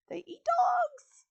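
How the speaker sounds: very high, loud, drawn-out outcry; shocked exclamation. Real, not acted. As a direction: squeal it in appalled disbelief. Reader's shocked reaction to the story.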